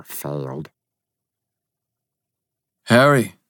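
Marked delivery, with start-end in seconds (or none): 2.9-3.4 calling out. Acted. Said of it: "Will called".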